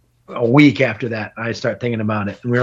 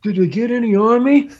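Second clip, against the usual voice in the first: much higher mock question, rising; imitating worried friend; 9 semitones higher, 3 dB louder than usual. Friend turning round to ask after the fall, high imitated question. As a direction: push the pitch right up, asking anxiously. Fallen friend asks, acted out.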